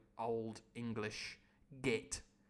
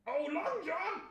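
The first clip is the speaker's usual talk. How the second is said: high posh call; slower than usual. Acted. The Squire calling Long John back, high and posh. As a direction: hail him back across the room.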